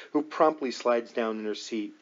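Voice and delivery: ordinary delivery, nothing marked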